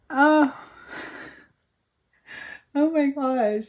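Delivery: normal talking voice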